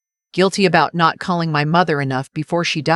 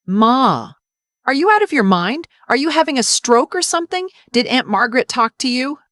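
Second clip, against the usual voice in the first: angry outburst; 7 semitones higher than usual. Acted. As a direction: shout in disbelief at mom. Son shouting at mother.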